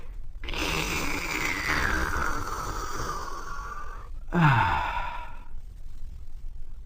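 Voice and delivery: soft low relieved sigh; long sigh. Acted. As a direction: sigh out after drinking. After a long drink.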